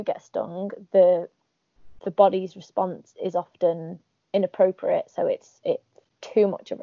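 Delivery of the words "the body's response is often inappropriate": ordinary talk, nothing marked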